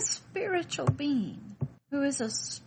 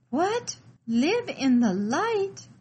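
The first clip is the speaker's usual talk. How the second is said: sharp high What, then slow, louder Live in the light; shocked disbelief; 4 semitones higher, 6 dB louder, slower than usual. Acted. Frightened little one answering the voice in the light, aghast. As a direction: recoil at the idea, drawing it out.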